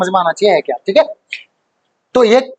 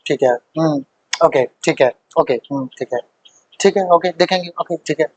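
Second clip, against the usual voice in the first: low, soft, unruffled murmurs; stoic; 7 semitones lower, 3 dB quieter than usual. Acted. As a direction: acknowledge it impassively, keeping the turmoil hidden. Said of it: "उस time पे वो कैसा बना रहा". (धीमी, संयमी आवाज़)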